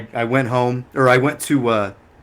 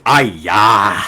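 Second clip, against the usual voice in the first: loud, drawn-out mock exclamation; hammed-up wail of dismay; imitated older shopkeeper; 3 semitones lower, 5 dB louder than usual. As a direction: imitate an exasperated shop owner's cry. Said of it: "he goes".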